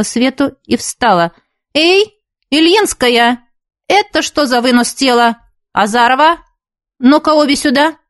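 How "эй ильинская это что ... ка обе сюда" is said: stern teacher call. A narrator's reading. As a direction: call them back like a strict teacher. Teacher calls girls back.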